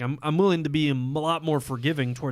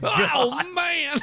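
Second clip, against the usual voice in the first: excited; 14 semitones higher than usual. Real, not acted. Hyped shout about fall.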